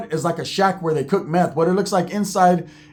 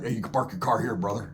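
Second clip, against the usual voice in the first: much lower and softer, a hushed gruff order; low biker voice; 8 semitones lower, 9 dB quieter than usual. Retold or imitated. Biker quietly telling Roach where to park, low voice. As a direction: play the compound biker keeping it low.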